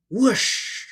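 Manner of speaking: plain reading voice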